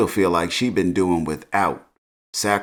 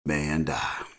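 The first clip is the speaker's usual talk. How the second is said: low, hushed, weighty rap quote; 6 semitones lower, 8 dB quieter than usual. Retold or imitated. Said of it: "She hit him with that Scarface".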